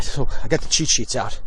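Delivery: normal talking voice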